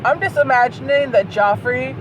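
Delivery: ordinary talk, nothing marked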